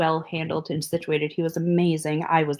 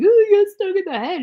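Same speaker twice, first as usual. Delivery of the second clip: high, loud whiny wail; mock crying; 12 semitones higher, 7 dB louder than usual. Going in to the wife about the sting, mock crying. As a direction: wail it like a hammed-up crybaby. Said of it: "like, crying".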